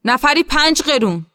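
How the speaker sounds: high, loud call to a crowd; shouting. A narrator's reading. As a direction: call out the fare over the engine. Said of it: "موسا فریاد زد".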